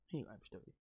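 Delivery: repeating the point almost under his breath; quiet repeat. Real, not acted. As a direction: drop it to an aside for yourself. Repeats line, second quieter.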